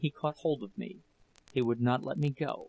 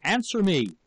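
high, raised demand; raised voice, falling hard at the end; angry shout; 6 semitones higher, 4 dB louder than usual. A narrator's reading. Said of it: "and shouted".

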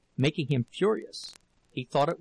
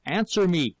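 louder, higher demand; angry cry; 5 semitones higher, 5 dB louder than usual. A narrator's reading. Husband demanding an answer from wife, angry cry. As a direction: cry it out as an order. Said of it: "he cried".

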